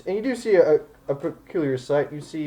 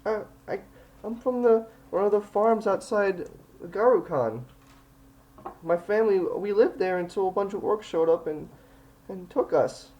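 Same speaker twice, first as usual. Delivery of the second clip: put-on high-pitched little girl voice; high child's voice telling of an orc raid; 9 semitones higher than usual. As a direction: play a small farm girl, pitched well up.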